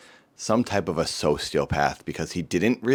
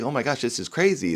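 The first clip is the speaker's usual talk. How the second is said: high and quick, overwhelmed amazement; amazed; 9 semitones higher, faster than usual. Retold or imitated. Reacting to the news with them, amazed and quick. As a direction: gush it out in shock.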